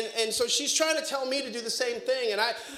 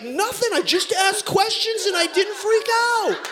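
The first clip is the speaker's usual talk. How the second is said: higher, louder, smug reassurance; proud dad; 10 semitones higher, 4 dB louder, slower than usual. Retold or imitated. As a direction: brag that you handled it. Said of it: "I'm like".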